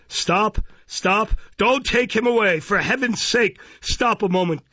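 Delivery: high-pitched, desperate plea to halt; breathless urgent cry. A narrator's reading. Newcomer rushing in to save the boy, breathless and crying out. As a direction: burst in crying out, voice pitched right up. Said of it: "cried the newcomer, breathless with haste".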